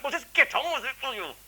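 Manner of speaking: put-on high, tense child telling the doctor to hurry; impatient boy. Boy on the bed urges the doctor to hurry, high and tense. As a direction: blurt it out like a scared boy bracing himself.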